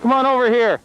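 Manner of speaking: loud call across the slope. Real, not acted. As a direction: call her over loudly. Dad calling daughter up.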